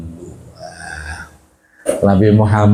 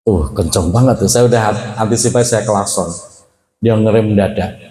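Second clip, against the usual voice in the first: usual speaking voice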